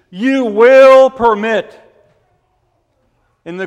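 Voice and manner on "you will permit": "will" louder and slow, a firm command; stressed command. Shown as a demonstration. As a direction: hammer the word "will", slowly and much louder. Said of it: "it means".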